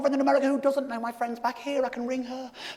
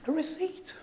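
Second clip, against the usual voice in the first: much softer, laughing under breath; deflated aside; 8 dB quieter than usual. Real, not acted. Comic repeating the punchline to the crowd, soft deflated chuckle. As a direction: let it drop quietly with a chuckle.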